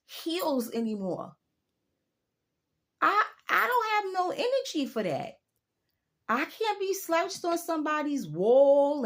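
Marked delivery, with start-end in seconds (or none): 3.0-9.0 high, emphatic no; emphatic refusal. Real, not acted. Refusing to lean on club walls, high and emphatic. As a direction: insist she is done with it.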